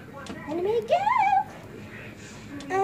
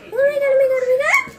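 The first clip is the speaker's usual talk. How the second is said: panicked; 6 semitones higher, 7 dB louder, much faster than usual. Real, not acted. The doll ride lifts her.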